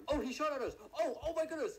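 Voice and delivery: high, loud shriek of alarm; panicked cry after being shot at; startled yell. Real, not acted. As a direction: yelp as the bullets fly. Suspect shoots at them.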